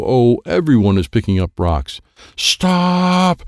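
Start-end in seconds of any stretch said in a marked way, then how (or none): 2.4-3.4 high, drawn-out storybook shout; commanding shout. A narrator's reading. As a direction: call out a long, commanding stop. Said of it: "Sensei Dennis commanded".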